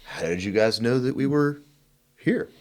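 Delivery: rising high in mock disbelief; mock surprise. Marines landing to find reporters, mock surprise. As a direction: pitch the question up like a baffled Marine. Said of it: "It was like".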